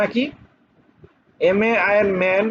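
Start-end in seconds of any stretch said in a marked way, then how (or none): none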